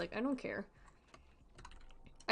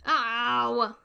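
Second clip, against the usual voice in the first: sharp loud ow of pain; real startled yelp; sudden yelp; 3 semitones higher, 9 dB louder than usual. Real, not acted. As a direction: yelp out as you die. Character dies in game.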